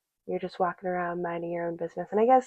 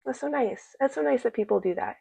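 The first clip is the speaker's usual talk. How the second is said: higher, gushing "oh, that's so nice"; touched; 6 semitones higher than usual. Retold or imitated. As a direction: coo over the sweet couple. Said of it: "gave me that feeling of like".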